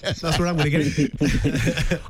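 slow, laughing through the words. Real, not acted. Cohost laughing at the elephant tattoo joke, slow and amused. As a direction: chuckle it out slowly.

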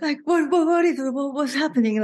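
frantic inner voice. Acted. Acting her frantic brain.

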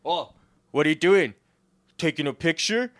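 mock call-out. Comic turns on the photographer behind him, mock call-out. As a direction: pounce on the photographer, mock-accusing.

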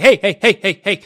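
loud insistent hey hey; mock alert shouting hey; car alarm voice. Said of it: "the car starts flashing at you and it's like".

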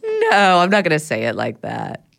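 ordinary talk

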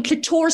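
"Clitoris" as cli-TOR-is, high like a name; mispronounced clitoris. Retold or imitated. Guest trying the word out as a name, mispronounced clitoris. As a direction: say "Clitoris" with the middle stressed, TOR. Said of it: "it's nicer that way".

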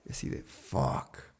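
low, slow whispered dismay; stunned whisper. Retold or imitated. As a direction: whisper it as the dread sinks in. Realizes his mother is gone. (susurro de espanto)

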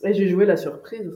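ordinary talk

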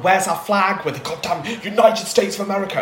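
lightly voiced outraged American official; mock indignation, accent mostly abandoned; indignant American team head. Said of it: "I, I can't do the accent".

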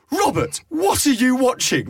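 a mum catching her son at it; mum calling out. Retold or imitated. Mum waking son, retold.

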